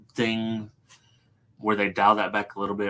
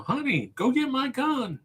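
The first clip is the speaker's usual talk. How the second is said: high-pitched movie-star impression; mock action-hero line, pitched way up; Mark Wahlberg. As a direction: put on a high voice and draw out the line. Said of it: "in the Mark Wahlberg voice".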